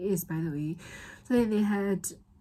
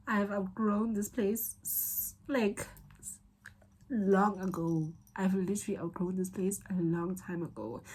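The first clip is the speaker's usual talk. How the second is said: normal talking voice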